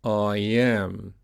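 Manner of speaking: "I am" joined by a y glide; "I am" as one unbroken word; linked practice model. Shown as a demonstration. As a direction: model "I am" as I-yam, drawn out. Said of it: "So let's practice that".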